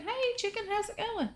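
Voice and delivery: squeaky high voice for an animal; high pet-talk voice. Real, not acted. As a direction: coo at the chicken in a tiny high voice. Greeting a chicken.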